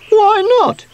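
very high, loud squeaky question; indignant squeak. A narrator's reading. Sweep challenges Sooty's ban on spray, squeaking. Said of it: "squeaked Sweep".

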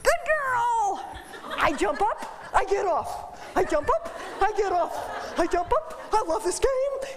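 high, playful dog voice; voicing the happy dog. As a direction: play the dog loving the game. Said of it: "voicing the dog's game".

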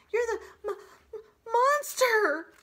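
high, loud stammering panic; terrified gasp. Acted. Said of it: "the opossum gasped".

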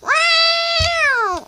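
high, stretched-out cry of pain; pained yelp. Acted. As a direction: yelp like a hurt kitten. Cat hurt, yelps.

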